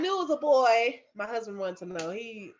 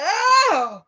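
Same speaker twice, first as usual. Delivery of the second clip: loud, high, drawn-out "oh"; acted contraction "oh"; 10 semitones higher, 10 dB louder than usual. Labouring mother hit by a stronger contraction, crying out loudly. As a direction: re-enact a sudden surge of pain.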